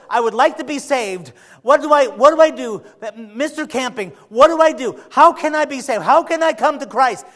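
high, loud pleading sinner voice; desperate caller. Retold or imitated. Desperate caller begging the radio host, high and loud. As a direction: beg for help like a man who feels lost. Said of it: "this guy calls up".

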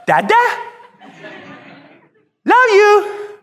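put-on high, sweet little toddler saying it. Father imitating his baby son's sleepy greeting, high toddler voice. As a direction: coo it like a fifteen-month-old. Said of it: "he looks in, he goes".

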